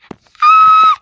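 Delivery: very high, loud startled shriek; startled scream. Acted. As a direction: scream in sudden fright. Sudden shaking in game.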